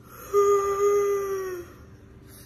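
long, high-pitched sigh. Real, not acted. Vlogger fretting over the lost spider, long sigh. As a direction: let out a drawn-out sigh.

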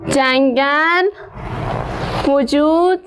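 slow, drawn-out dictation reading; slow reading aloud. Shown as a demonstration. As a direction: read each word slowly for the pupils. Teacher reads sentence slowly for class. (خواندن آهسته برای شاگردان)

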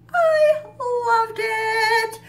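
squealed high and sung out with delight; excited squeal. Real, not acted. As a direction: gush like a thrilled fan. Gushing over the scene.

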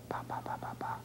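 soft unvoiced whispered ba ba. Shown as a demonstration. Therapist modeling a whisper for Dina. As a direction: breathe the syllables with no voice. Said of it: "Can you whisper?".

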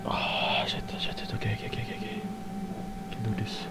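very soft, barely audible whispering; hushed, repetitive self-calming; frightened whisper. Real, not acted. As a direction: whisper nervously to yourself. Frightened, steadying self in game.

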